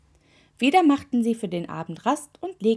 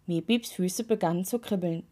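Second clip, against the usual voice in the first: usual speaking voice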